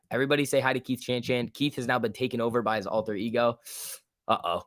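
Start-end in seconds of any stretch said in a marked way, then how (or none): none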